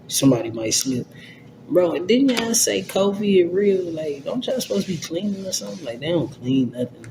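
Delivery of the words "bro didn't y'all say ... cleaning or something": incredulous sarcasm. Real, not acted. Mocking coworkers about cleaning.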